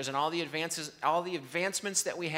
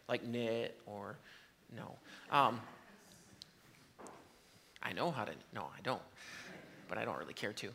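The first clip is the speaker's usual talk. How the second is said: quiet aside; 6 semitones lower, 10 dB quieter than usual. Real, not acted. Self-deprecating aside about knitting.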